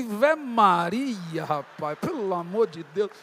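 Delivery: slow, stretched cry of dismay; aghast exclamation. Retold or imitated. Learning the other man is also a pastor, drawn-out dismay. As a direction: draw out the first words, aghast.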